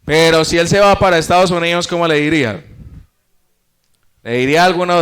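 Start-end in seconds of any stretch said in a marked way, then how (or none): none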